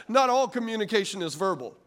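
ordinary delivery

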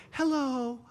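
a hammed-up gentle officer asking nicely; mock polite cop. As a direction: sweet-talk the driver like a too-gentle cop. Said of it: "They yell".

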